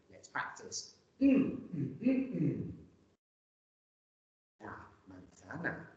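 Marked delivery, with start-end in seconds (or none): 1.2-2.7 closed-mouth hum tapping out syllables; humming the rhythm. Shown as a demonstration. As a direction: hum four beats in the rhythm of the word. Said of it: "I will hum".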